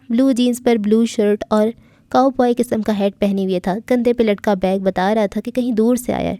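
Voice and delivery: usual speaking voice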